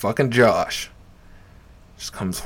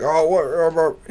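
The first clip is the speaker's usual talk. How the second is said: higher, blustering mumble; mocking heckler imitation; 6 semitones higher than usual. Comic imitating heckler going off, mocking. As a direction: mimic a heckler sputtering nonsense.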